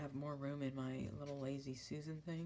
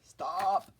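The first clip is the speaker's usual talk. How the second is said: sudden loud laughing "Stop!"; playful loud self-scold; laughing shout; 4 semitones higher, 12 dB louder than usual. Real, not acted. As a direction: snap at yourself with a laugh. Laughing mock scolding herself.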